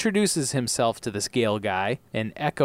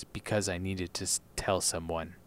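quiet, low; 6 semitones lower, 9 dB quieter than usual. A narrator's reading. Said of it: "And Echo says".

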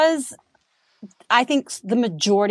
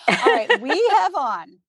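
normal talking voice

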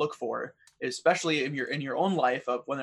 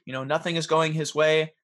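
ordinary talk

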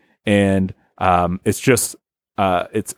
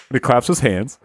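ordinary talk, nothing marked

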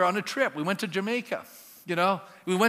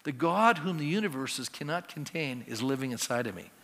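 hushed, marveling murmur of wonder; hushed awe; 8 semitones lower, 6 dB quieter than usual. Acted. A believer marveling to himself about God within, hushed awe. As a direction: breathe it out in quiet amazement.

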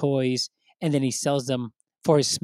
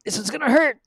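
Michael Scott; 12 semitones higher, 6 dB louder than usual. Retold or imitated. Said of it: "in Michael Scott's words".

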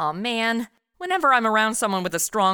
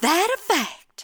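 slow, higher put-on southern drawl; southern accent; 9 semitones higher, slower than usual. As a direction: drawl it out like a local. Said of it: "I always start talking like 'em".

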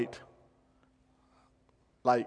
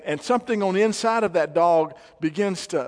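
ordinary delivery

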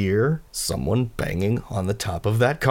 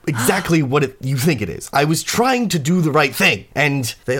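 raised, halting self-justification; flustered excuse; 6 semitones higher, 4 dB louder than usual. Acted. Fads explains to a shocked Warble, flustered pleading. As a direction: protest that you meant well.

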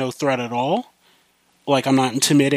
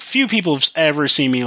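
ordinary delivery

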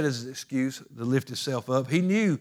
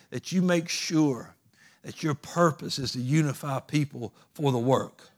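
ordinary talk

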